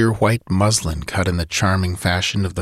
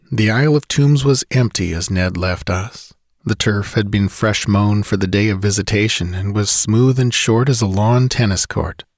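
plain reading voice

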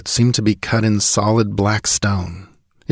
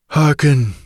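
mildly commanding, pitched higher; 6 semitones higher, 3 dB louder than usual. A narrator's reading. Ancient sage orders Conan to listen, lightly commanding. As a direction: call for attention with some authority. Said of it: "spoke the other commandingly".

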